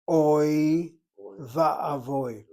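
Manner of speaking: long loud "Oi", a pause, then "va'avoy"; emphatic split "Oi ... va'avoy"; oi combination. Shown as a demonstration. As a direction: hold "Oi" out loudly, break, then say "va'avoy". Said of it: "the oi combination".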